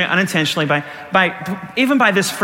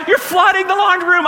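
high-pitched accusing yell; alarmed daughter; 13 semitones higher, 3 dB louder than usual. Retold or imitated. Daughter to dad as laundry room floods, mock alarmed yell. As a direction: imitate a kid shouting in alarm.

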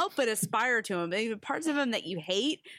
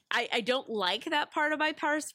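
ordinary talk, nothing marked

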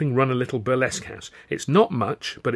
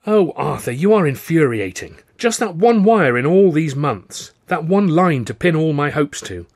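loud, high, indignant tirade; exasperated outburst; 7 semitones higher, 6 dB louder than usual. A narrator's reading. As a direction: let the pent-up frustration pour out. Exasperated quarrel, calls him infuriating.

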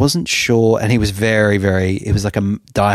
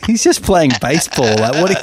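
incredulous; 7 semitones higher, 3 dB louder than usual. Retold or imitated. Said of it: "I was like".

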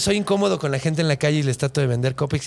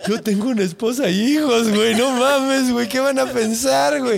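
panicking; 6 semitones higher, 4 dB louder than usual. Retold or imitated. Man panics about his family.

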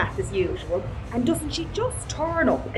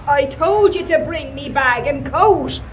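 exasperated retort; 4 semitones higher, 6 dB louder than usual. Retold or imitated. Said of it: "I said".

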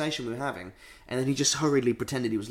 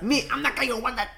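put-on high-pitched botched Scottish accent through laughter; failed Edinburgh accent; 11 semitones higher than usual. Retrying the ticket winner's line, bad Edinburgh accent. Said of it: "I can't do it".

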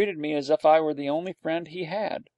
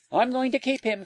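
put-on high-pitched boy insisting firmly; determined child's voice, pitched up; 11 semitones higher than usual. A narrator's reading. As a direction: correct them, allowing no argument. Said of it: "corrected Peter firmly".

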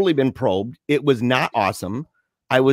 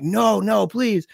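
begging; 6 semitones higher, slower than usual. Retold or imitated. Begging in retold exam.

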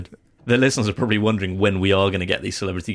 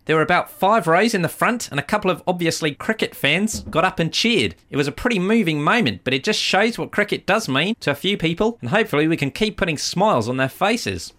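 put-on voice, much higher throughout; Ross Taylor; 10 semitones higher than usual. Captain on fans cheering on the plane, high put-on voice. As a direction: play the quoted captain in a high, lilting voice.

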